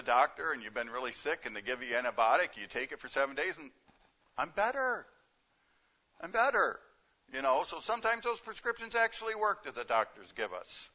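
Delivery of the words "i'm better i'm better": higher, lilting "I'm better" of a cured patient; relieved patient. Retold or imitated. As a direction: sing out the relief of getting well, lightly acted. Patient relieved after antibiotics.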